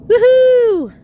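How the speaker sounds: high, loud whoop; long falling cheer. Real, not acted. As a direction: celebrate the kick out loud. Cheering in kick game.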